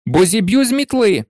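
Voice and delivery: high, urgent threat; shouted threat. A narrator's reading. Harry threatening Malfoy in mid-air, shouted threat. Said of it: "крикнув Гаррі".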